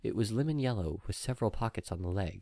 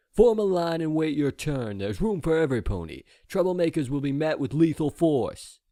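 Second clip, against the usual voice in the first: stern soldier; 8 semitones higher, 6 dB louder than usual. Acted. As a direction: bark the order at the crowd. Soldier barking orders.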